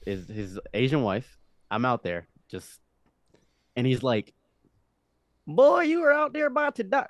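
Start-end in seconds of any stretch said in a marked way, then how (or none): 5.5-7.1 Southern rancher voice, pitched way up; a thick Southern accent, high and loud. Retold or imitated. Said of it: "Speaking a very Southern accent".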